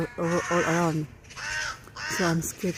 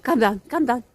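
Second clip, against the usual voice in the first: higher, louder pleading call; anxious calling; 5 semitones higher, 5 dB louder than usual. Real, not acted. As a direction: call her name up into the branches, higher and louder. Calling cat down from tree.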